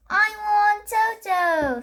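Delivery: high, slow sing-song demand; a small boy chanting what he wants; little brother. A narrator's reading.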